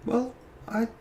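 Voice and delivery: high, halting, uncertain voice; troubled, anxious character. Acted. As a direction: falter anxiously, pitched well up. Plays Nezriel answering anxiously.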